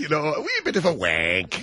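stage Irish brogue; Barry Fitzgerald style brogue; Irish accent. Retold or imitated. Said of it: "Barry Fitzgerald school of Irish accents".

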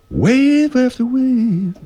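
high, slow, stretched-out announcer chant; wave after wave. Pretend. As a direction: sing it out slow and high like a dramatic promo. Drawn-out mock announcer line.

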